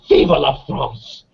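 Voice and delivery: slow, drawn-out French exclamation, a hint of tears; French girl's tearful cry. French girl in tears after the anthem, slow moved French cry. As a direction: savour each French word slowly, mildly moved. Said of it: "And she says".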